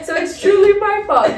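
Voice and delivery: high, loud, laughing through the words; laughing admission. Real, not acted. Client jokingly takes the blame after therapy, laughing. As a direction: laugh while owning up to it.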